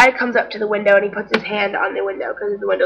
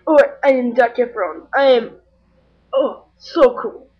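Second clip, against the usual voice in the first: mild mock arrogance, louder; mocking Zac Efron; 3 semitones higher, 5 dB louder than usual. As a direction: sneer a vain celebrity impression. Paparazzo mocks Zac Efron.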